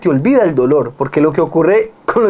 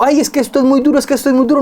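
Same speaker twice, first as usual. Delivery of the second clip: higher, half-hearted mock lament; mock whining complaint; 8 semitones higher, much faster than usual. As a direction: imitate a tired mother's moan without really crying. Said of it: "Y no está llorando diciendo".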